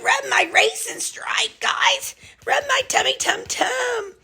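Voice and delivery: high, breathy whispered dog voice. Acted. Owner voicing her dog begging for belly rubs, high whisper. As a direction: coo the dog's request in a tiny high whisper. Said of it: "he said".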